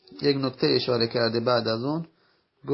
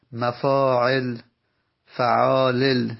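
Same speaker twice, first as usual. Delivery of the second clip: slow pattern recital; 3 dB louder, much slower than usual. Shown as a demonstration. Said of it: "یعنی قالبش".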